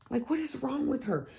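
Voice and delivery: high-pitched disbelief; incredulous. Real, not acted. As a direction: soar up in total outrage.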